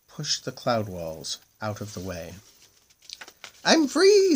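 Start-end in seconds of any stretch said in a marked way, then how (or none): 3.6-4.3 very high, loud squeal of joy. Acted. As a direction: squeal with delight at being free. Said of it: "squealed the moon".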